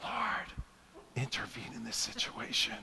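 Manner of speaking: hushed whisper, barely breathed; whispered prayer. Retold or imitated. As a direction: whisper it so no one nearby hears. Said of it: "trying to whisper the prayer".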